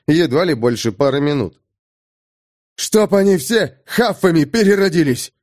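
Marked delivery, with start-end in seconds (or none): 2.8-5.3 shouted out. Acted. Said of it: "почему-то выкрикнул".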